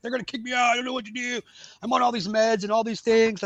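high-pitched frantic plea, mock distress; panicked retelling. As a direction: act out freaking out to a therapist. Frantic plea retold to therapist.

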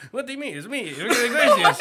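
high, laughing, hammed-up pop-star voice. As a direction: insist it's really him, cheesy and high. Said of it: "cringiest imitation of [name]".

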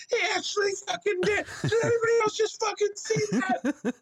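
very high, overexcited mock disbelief; high-pitched hammed-up amazement; imitating amazed hype man. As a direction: squeal it like a fan who can't believe it. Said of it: "The guy was like".

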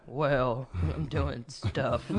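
low, very slow Rick imitation; mock Rick voice. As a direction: drag it out low like Rick stalling.

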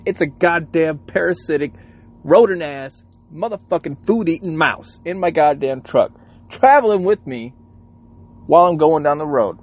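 high, loud indignant rant; worked-up cursing tirade; mad. Real, not acted. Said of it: "but I'm mad".

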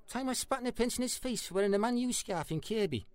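ordinary talk

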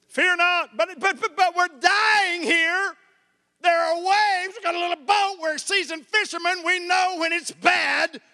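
high, loud panicked protest over the storm; frantic fishermen. Retold or imitated. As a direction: yell it like men who are sure they will drown.

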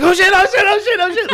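very high, frantic squeal; hammed-up high-pitched freak-out; mock panic. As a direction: imitate someone flailing over a sudden catch. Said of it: "And you're like".

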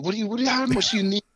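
pitched high, rising in disbelief; retold incredulous question. Podcaster retelling his question to the drummer, high and incredulous.

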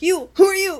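loud, high mock challenge; accusing confusion. Confused people pointing at each other, loud mock accusing.

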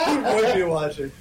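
loud laughing aside; laughing remark. Real, not acted. Listener laughing.

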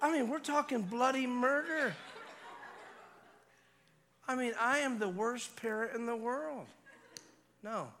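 high-pitched comic exasperation; mock distress. Father recounting daughter's screaming fit, high and overwrought. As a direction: wail it over the imagined screaming.

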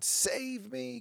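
high-pitched mocking plea for rescue; mocking a crowd begging to be saved, high and pleading; mock screaming. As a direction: whine it way up high like a needy crowd. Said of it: "So many people screaming".

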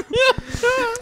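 shrieking laugh; helpless high laughter; high laughing fit. Real, not acted. Laughing hard.

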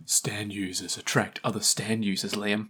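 soft, breathy dramatic whisper; ominous whisper. Pretend. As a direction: whisper it like a dark secret. Ominous dramatic reveal line.

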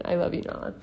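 soft unvoiced whisper of love; whispered to the dog. Real, not acted. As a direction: whisper it tenderly to your dog. Aside to her dog.

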